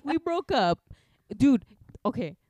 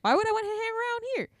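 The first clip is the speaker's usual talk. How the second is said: exasperated outburst; 13 semitones higher, much faster than usual. Retold or imitated. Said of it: "I was like".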